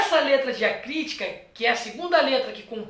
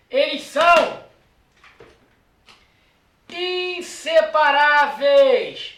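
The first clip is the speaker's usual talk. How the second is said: inseparáveis drawn out slowly and loud, each syllable stretched; stressed slow; 6 dB louder, much slower than usual. Shown as a demonstration. Teacher drilling students on inseparáveis, slow and loud. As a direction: lean on inseparáveis, slow and emphatic. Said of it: "Olha aqui, inseparáveis".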